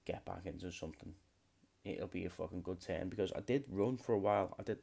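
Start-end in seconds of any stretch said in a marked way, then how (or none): none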